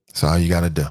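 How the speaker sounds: normal talking voice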